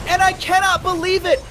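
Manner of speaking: high, disbelieving excited yell; excited shrieking. Real, not acted. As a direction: keep shouting in disbelief. Caster still shrieking at the teamfight.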